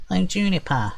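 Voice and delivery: high, perky little sprite voice. Acted. Tree sprite introducing himself to the party, perky and high. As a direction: chirp it brightly, high up. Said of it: "he says in a perky, high-pitched voice".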